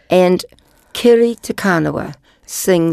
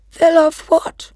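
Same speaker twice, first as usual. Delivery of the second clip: puzzled question; 11 semitones higher than usual. Acted. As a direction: ask it amazed.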